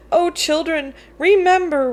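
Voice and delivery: plain reading voice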